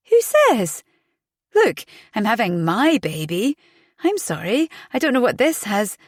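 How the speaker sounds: shocked retort. A narrator's reading. Shocked she's pregnant is known.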